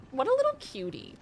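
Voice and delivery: high cooing voice; cooing at a cutie. Real, not acted. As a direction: coo at the little animal.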